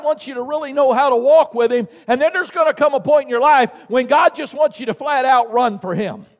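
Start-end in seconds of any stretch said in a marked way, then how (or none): none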